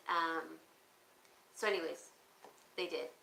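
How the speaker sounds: ordinary delivery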